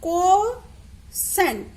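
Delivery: কোস্যান্ট drawn out very slowly and clearly; teacher modelling কোস্যান্ট slowly; pronounce cosecant slowly. Shown as a demonstration. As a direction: stretch কোস্যান্ট out for the class. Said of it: "চার নম্বরটাকে বলা হচ্ছে".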